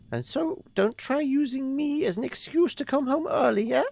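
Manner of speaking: put-on high, airy old woman voice; a fond grandmother reminiscing; old lady voice. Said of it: "So we should do the old lady voice".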